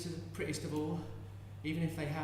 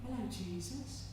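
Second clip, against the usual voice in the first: soft cooing; 9 dB quieter than usual. Acted. Mother cooing to a baby.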